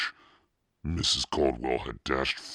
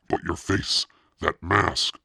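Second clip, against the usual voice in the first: stammer; 5 semitones higher, 6 dB louder than usual. A narrator's reading. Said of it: "Noah stammered".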